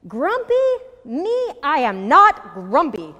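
grumpy protest, leaping up then slow and loud; indignant denial. A narrator's reading. Jim denies to Marabou that he is grumpy, indignant. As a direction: protest loudly, stretching the denial.

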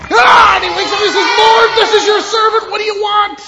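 very loud, high-pitched startled yelling; startled awake, yelling high and fast; frantic shout. Acted. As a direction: shout it all at the top of your voice. Story climax shouted after call.

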